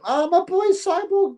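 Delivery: high, slow delighted hail to a friend; light up as a favourite viewer arrives; excited greeting. Real, not acted. Streamer greeting his favourite viewer Cyborg, delighted and high.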